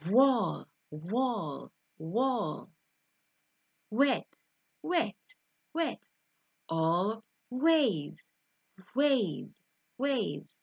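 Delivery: wall, wet, ways, each repeated with a puffed, rounded w; candle-blowing W. Shown as a demonstration. Teacher drilling W words for learners, puffed w. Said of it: "Imagine you're blowing out a candle".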